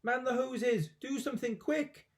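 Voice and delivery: high, frantic alarm calls; grandma on fire, frantic high yells; panicked yelling. Acted. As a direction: bark orders in a panic. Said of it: "the old woman yelled".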